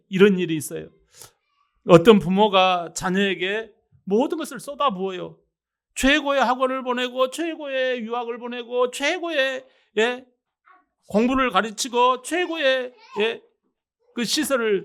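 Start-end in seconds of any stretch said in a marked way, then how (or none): none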